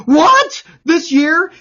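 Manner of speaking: retold shocked shriek; screamed out. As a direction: shriek it again the way she did at the reveal. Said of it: "I screamed out".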